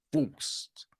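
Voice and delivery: breathy whisper on one word. Real, not acted. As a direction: drop to a whisper for emphasis. Emphatic aside on forced acting.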